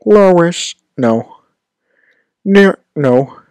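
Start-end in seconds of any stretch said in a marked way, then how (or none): 0.0-0.7 high cartoon-dad call, poorly imitated; Peter Griffin. Streamer retrying an old impression, high cartoon-dad voice. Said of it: "I used to be able to do Peter Griffin". 2.4-2.8 high cartoon-dad attempt; an aborted, raised-pitch impression; Peter Griffin. Streamer failing an old impression, high cartoon voice. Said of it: "I can't do it".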